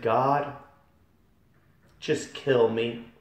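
loud, slow desperate plea; crying out to God. Retold or imitated. Pleading to God in hell to be killed, crying out. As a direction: beg God to end it. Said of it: "I cried out".

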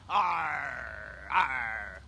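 pirate growl. Pretend. Streamer growls at chat as a mock pirate. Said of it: "I got to talk like a pirate".

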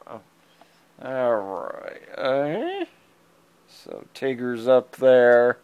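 ordinary delivery

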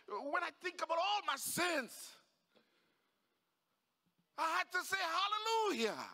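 high, building to a loud hallelujah; swelling excitement ending in a shout; overjoyed father. Acted. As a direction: explain it, then burst into praise. Father justifies his praise shout.

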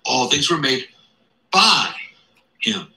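pause, then hit "by" hard; stress on by. Shown as a demonstration. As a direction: isolate "by" with a big lift. Quotes the key preposition.

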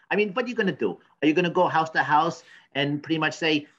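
ordinary delivery

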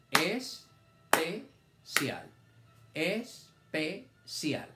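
very slow, es-pe-cial split into syllables; sounded out syllable by syllable. Shown as a demonstration. Teacher reading aloud to young pupils, syllable by syllable. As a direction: stretch each syllable apart for the children. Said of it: "Leer es hacer los sonidos todos pegaditos".

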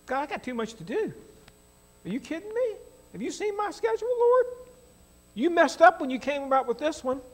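high, exasperated whine at the Lord; mock complaint to God. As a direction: play the overworked man griping at God, half joking. Preacher voices complaint to God.

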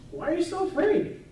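high, sharp rebuke; Jesus rebuking disciples. Acted. Jesus asks the fearful disciples, rising rebuke. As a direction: ask it as Jesus scolding his frightened disciples. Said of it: "Jesus turns to his disciples and says".

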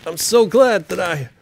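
put-on loud, high American-accented boasting; brash American Guy Fawkes; hammed-up American accent. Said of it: "American accent for Guy Fawkes".

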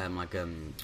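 ordinary talk

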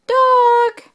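high, loud "dog"; excited. Shown as a demonstration. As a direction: call out "dog" brightly. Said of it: "phonics word".

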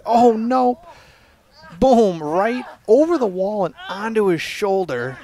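high, loud mock-alarmed exclamation; excited play-by-play. Real, not acted. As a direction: call the hit big and loud, drawing it out. Reacting to dog's leap in video.

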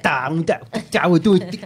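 choppy mock Creole syllables; mimicking Mauritian Creole. Man showing how Mauritian French sounds to him, mock Creole. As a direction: imitate Creole as staccato nonsense sounds. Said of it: "it all sounds like".

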